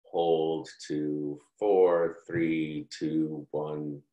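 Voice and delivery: soft, low breath count; hushed meditation count; quiet counting. Retold or imitated. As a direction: count slowly and softly like guiding a breath. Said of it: "She said".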